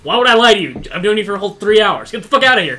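loud, high, urgent yelling; frantic shouting. Acted. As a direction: shove them out the door, shouting. Rushing gnomes out the door.

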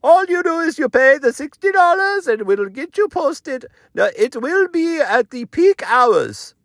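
high-pitched put-on salesman voice; high, strained character voice, stretched at the end; tech guy Sushel. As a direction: pitch it way up as the Instagram promoter. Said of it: "Okay, how's it work?".

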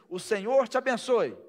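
loud, proclaiming blessing; preached blessing. Acted. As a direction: proclaim it over the crowd. (bênção proclamada em voz alta)

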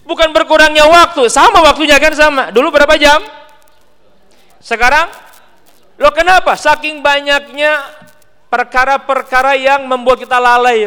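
a preacher rousing the hall; forceful preaching. Acted. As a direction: press the point hard on the congregation.

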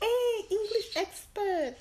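high, drawn-out imitation of a roommate; mock greeting. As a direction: hail someone slowly in a high imitated voice. Roommate hailing others, retold.

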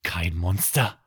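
usual speaking voice

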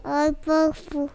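words through a mouthful of mic; drooling. Real, not acted. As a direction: mouth pressed to microphone. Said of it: "Você tá comendo o microfone".

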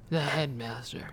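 hushed whisper, drawn out slowly; mock-ominous title. Wife naming the head preschool person, slow mock-ominous whisper.

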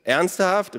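high, loud incredulous question; boy's disbelieving call; calling back. A narrator's reading. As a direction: shout it back from a distance. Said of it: "rief er".

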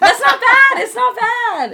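high squealing laugh through words. Real, not acted. Friends teasing, laughing high and loud protest.

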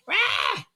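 high, loud mock yelp of pain; sudden high cry; shriek. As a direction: yelp like a kid who just got hit. Sister struck, crying out.

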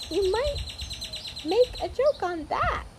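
very high, slow tiny creature; little voice. A narrator's reading. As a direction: squeak it out high and small. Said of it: "said the little voice".

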